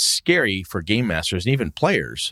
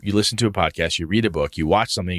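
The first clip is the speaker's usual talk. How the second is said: normal talking voice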